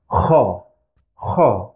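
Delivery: Kha scraped like a snore from the throat. Shown as a demonstration. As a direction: rasp Kha in the throat, not the nose. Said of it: "someone who is snoring".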